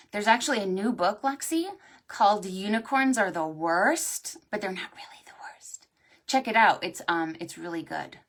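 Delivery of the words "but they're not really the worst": quick hushed aside; whispered aside. Real, not acted. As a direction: whisper it like a secret.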